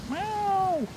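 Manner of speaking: very high, drawn-out meow; wailing cat. A narrator's reading. Frightened cat answering boy from wet roof, wailing. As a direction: wail it high, falling at the end. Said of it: "wailed the cat".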